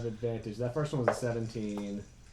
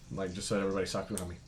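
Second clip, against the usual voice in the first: normal talking voice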